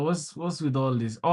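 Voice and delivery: ordinary delivery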